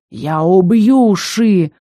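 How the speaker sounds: медленная рычащая угроза; прорычать угрозу, растягивая слова; злобный рык. A narrator's reading. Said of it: "рыкнул некромант". (медленно, с рыком)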